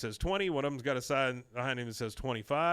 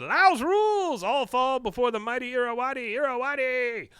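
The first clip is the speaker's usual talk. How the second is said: triumphant battle cry; 12 semitones higher, 5 dB louder than usual. Retold or imitated. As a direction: roar it like a conquering champion. Khan boasting at eating contest.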